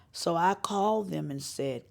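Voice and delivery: ordinary delivery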